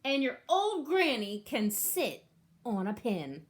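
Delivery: rude taunt; yell. Retold or imitated. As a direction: shout the insult. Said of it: "the third little pig yelled".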